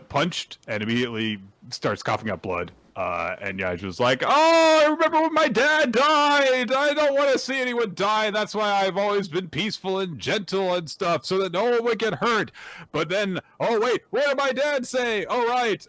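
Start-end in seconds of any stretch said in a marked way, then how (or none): 4.2-15.8 put-on emote it like a melodramatic boy; hammed-up high anguish; anguished hero. Voicing Yajima's flashback monologue.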